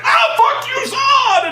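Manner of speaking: very high, loud hammed-up battle yell; mocking Superman bashing Zod, shrieking high and loud; mock Superman shout. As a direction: scream it like a cartoon brawler.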